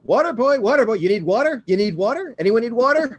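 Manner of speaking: high, loud, fast water-seller hawking; peddling water to the players, high and hammed-up; water boy hawking. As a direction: call out like an eager street hawker peddling water.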